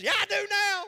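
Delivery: high, loud, pressured-out yes; a victim finally giving in, high and loud; mock coerced yes. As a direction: squeak out a forced agreement.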